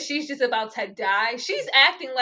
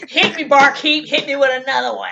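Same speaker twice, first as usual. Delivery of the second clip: mock barfly order; 2 semitones higher, 6 dB louder than usual. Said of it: "he all at the bar".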